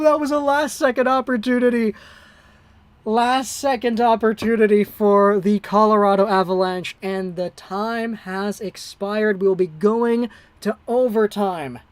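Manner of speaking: hockey call at a broadcaster's pace, rising with the action; amateur broadcaster calling a tight third period; live play-by-play. Acted. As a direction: call the game like a TV announcer. Streamer calls the game live.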